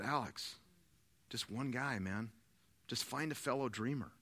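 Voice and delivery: low, soft coaxing; gentle encouragement. Retold or imitated. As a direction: quietly urge on a discouraged friend. Mentor retelling his encouragement to Alex.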